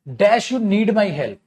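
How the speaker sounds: slow reading. Shown as a demonstration. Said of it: "teacher reads question slowly".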